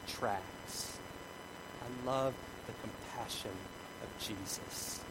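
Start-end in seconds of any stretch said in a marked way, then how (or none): 1.8-5.0 much softer, low and slowly spaced; hushed tenderness. Real, not acted. Preacher savoring the compassion of Jesus, hushed. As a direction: drop almost to a murmur. Tender aside after climax.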